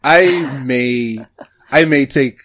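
ordinary delivery

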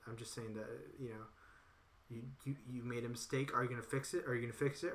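usual speaking voice

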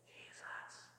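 a breathy whisper of the name Jesus; whispered answer. Pretend. Preacher whispering the obvious answer to his congregation. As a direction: whisper it like a secret punchline.

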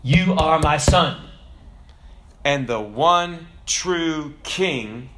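slow, solemn proclamation with long pauses; Mufasa. Acted. Ghost father naming his son the true king, stately and commanding. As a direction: declare it word by word, swelling in the middle. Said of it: "he continues and said".

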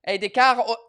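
amateur Brazilian accent, breaking off; attempted Brazilian Portuguese accent. Shown as a demonstration. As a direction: have a halting go at Brazilian Portuguese. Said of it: "I can almost imitate a Portuguese Brazilian accent".

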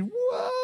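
a high thrilled squeal; squealing child whoa. Retold or imitated. Little girl squeals as dad throws her up. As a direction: squeal like a toddler tossed up.

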